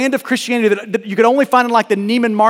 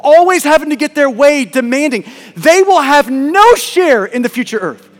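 preaching at full force; 6 semitones higher, 6 dB louder than usual. Acted. Pastor preaching to the congregation, rising and louder. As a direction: drive the point home from the pulpit.

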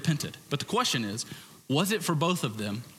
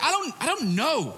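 a higher, flustered I don't know; flustered denial; 11 semitones higher than usual. Retold or imitated. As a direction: stammer it out, caught off guard. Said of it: "It's like".

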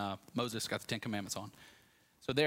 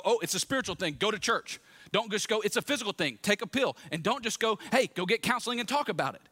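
higher, quick-fire mock advice; glib advice; 8 semitones higher, 4 dB louder than usual. Preacher mimics people giving one-answer advice, glib and pitched up. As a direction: rattle off dismissive advice, pitched up.

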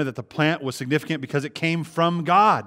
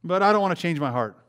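higher, louder, smug entitled voice, slowing on deserve great things; mocking self-important quote; 6 semitones higher, 7 dB louder than usual. Preacher mimicking a selfish believer, smug and entitled. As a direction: puff up like a spoiled man demanding blessings. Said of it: "He says".

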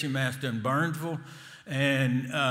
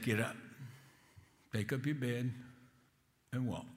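gentle command; 3 semitones lower, 12 dB quieter than usual. Acted. Jesus heals the invalid.

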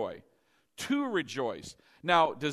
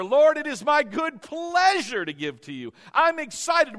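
higher, louder, eager praise; cheerful giver; 9 semitones higher, 8 dB louder than usual. Retold or imitated. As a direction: bubble over with excitement to give. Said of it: "if you're a cheerful giver".

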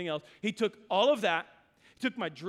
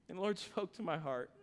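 normal talking voice

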